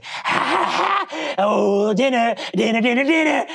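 hungry cartoon monster gloating; playful sing-song menace, building in pitch; gleeful villain voice. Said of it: "You hear a voice say".

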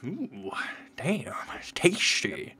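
slow savouring whisper, almost breathless. Real, not acted. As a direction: whisper it slowly as if tasting something good. Savouring food while gaming.

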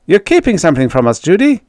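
loud, high, quick challenge; suspicious accusation. A narrator's reading. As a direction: accuse her over the radio. Dan accuses Judy over radio.

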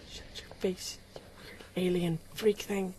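soft hushed insult. Real, not acted. Gamer quietly insulting an alien creature.

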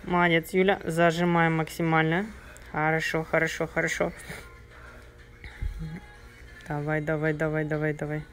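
quiet urging during a set; soft coaching encouragement. Real, not acted. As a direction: gently egg them on, softly.

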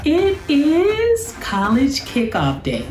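high, bright announcement of the big day; excited. Real, not acted. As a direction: sing it out like a surprise reveal. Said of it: "I am so excited".

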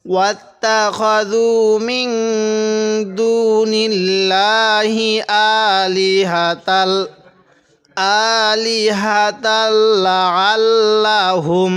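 slow chanted tajweed recitation; Quran recitation. Acted. Teacher reciting the verse for students, slow chant. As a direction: recite it slowly with long held vowels.

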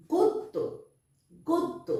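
গদ্য called out high with the দ doubled, গদ্-দ; emphatic model word. Shown as a demonstration. Teacher calls out গদ্য for the children to repeat. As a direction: sing out the model word for the class. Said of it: "আবার যদি বলি গদ্য".

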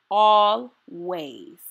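drawn-out always. Acted. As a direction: let the echo linger gently. Repeated affirmation, echo.

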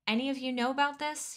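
demanding. A narrator's reading. Team leader questioning a crowd of workers, acted demanding tone. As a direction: press the crowd for an answer, mildly angry. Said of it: "he demanded".

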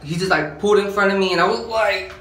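ordinary talk, nothing marked

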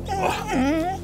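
ordinary talk

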